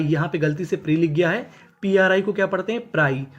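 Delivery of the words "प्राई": usual speaking voice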